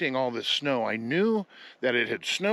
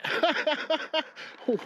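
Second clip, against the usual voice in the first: amused high laugh. Real, not acted. Laughing at skier's plan.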